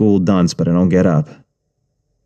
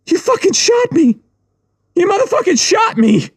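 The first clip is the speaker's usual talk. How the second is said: high panicked shriek; pained scream. Acted. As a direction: scream in disbelief at the shooter. Shot man screaming at shooter.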